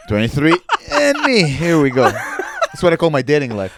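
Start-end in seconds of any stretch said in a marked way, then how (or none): 0.1-2.0 loud, giggly mock resolve; laughing through a mock send-off; laughing imitation. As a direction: act it out while cracking up. Acts out customer, laughing.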